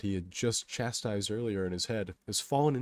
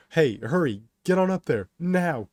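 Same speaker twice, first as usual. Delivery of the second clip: loud, high urgent command; yelling; 8 semitones higher, 9 dB louder than usual. A narrator's reading. Kato urging him onto the platform, urgent yell. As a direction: yell it, punching each phrase. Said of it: "Kato yells".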